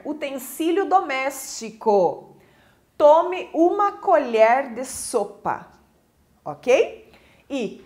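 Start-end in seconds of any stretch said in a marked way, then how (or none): 3.9-4.7 COLHER with an open E. Shown as a demonstration. Teacher giving a soup spoon example, open E in colher. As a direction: say colher slowly with the open vowel. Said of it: "pronúncia com o som aberto".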